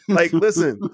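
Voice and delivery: real laugh breaking into the words. Real, not acted. Fan venting to podcast cohosts about sold-out jerseys, laughing. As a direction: laugh through it as you start the rant.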